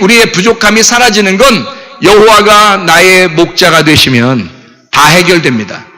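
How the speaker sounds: ordinary delivery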